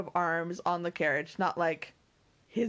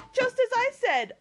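high, loud, gleeful triumph; ecstatic cry; 6 dB louder than usual. Acted. Mary proved right about her cousin, ecstatic cry. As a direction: crow it in high-pitched delight. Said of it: "cried Mary in ecstasy".

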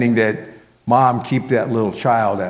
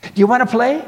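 put-on high, loud child's voice; high-pitched little-girl question; little girl asking; 14 semitones higher, 6 dB louder, faster than usual. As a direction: ask eagerly like a small child wanting company. Child asks narrator to play.

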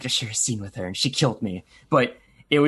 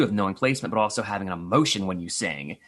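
usual speaking voice

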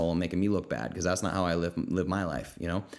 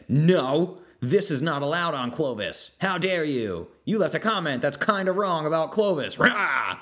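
louder, high huffy tell-off ending in a growl; mock indignant scolding; 7 semitones higher, 4 dB louder, slower than usual. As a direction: play the outraged forum cop. Mocking pushy group members.

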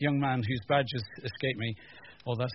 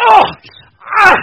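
pained yelp; 21 dB louder than usual. Retold or imitated. Hitting thumb with hammer.

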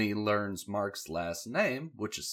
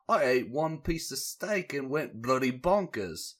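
mock British; 6 semitones higher, 5 dB louder than usual. Steven quoted.

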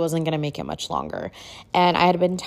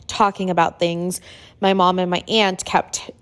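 usual speaking voice

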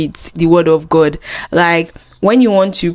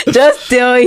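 laughing through the words, higher and giggly; 5 semitones higher than usual. Real, not acted. Teasing her friend Eric about going to God, laughing. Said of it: "Oh my God".

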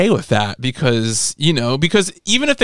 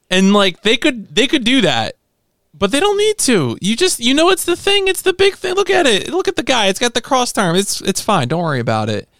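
excited; 9 semitones higher than usual. Real, not acted. Excited high rant about mecha.

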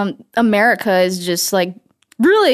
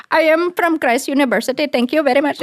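put-on Indian accent; 6 semitones higher than usual. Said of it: "we were like".

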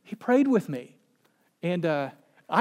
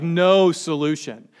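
usual speaking voice

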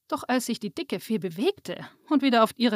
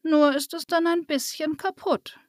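a touch of regret, pitched higher; sad; 6 semitones higher than usual. A narrator's reading. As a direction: say it a little ruefully. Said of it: "meinte Waltrudis traurig". (ein wenig traurig)

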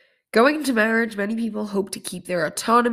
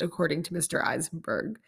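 ordinary delivery

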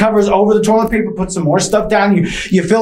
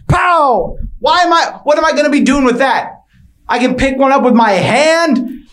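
ranting; 7 semitones higher than usual. Acted. Ranting about pancakes.

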